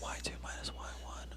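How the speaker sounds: hushed whisper. Real, not acted. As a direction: whisper it secretly. Whispering answers to teammate.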